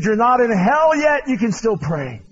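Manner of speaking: Finney preaching. Retold or imitated. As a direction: declare hope like the old revivalist. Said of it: "he said".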